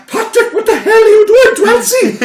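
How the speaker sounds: very high, loud, laughing mock outrage; outraged mother. Mother walks in on her son, loud and outraged. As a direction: squeal it like a shocked mum bursting in. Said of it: "She's there".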